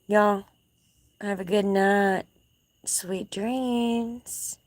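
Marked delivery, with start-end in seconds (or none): 2.8-4.6 whispered goodnight. Real, not acted. Signing off the livestream to viewers in a whisper. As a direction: breathe it out like a lullaby goodnight.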